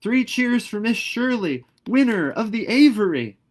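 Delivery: high, loud cheer shouted across a hall; rousing shout of cheers; calling out. Acted. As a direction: announce the winner at full voice. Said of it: "somebody called out".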